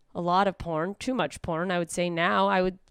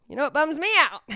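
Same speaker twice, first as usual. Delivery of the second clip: normal talking voice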